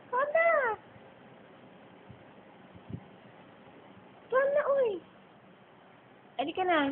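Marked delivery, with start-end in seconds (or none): 0.1-4.9 soft, high-pitched pet coaxing; high and light, urging it to come; coaxing call. Real, not acted. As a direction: coax a pet over in a small high voice.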